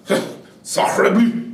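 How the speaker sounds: slow, laughing French exclamation; French accent. Retold or imitated. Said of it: "The French were like".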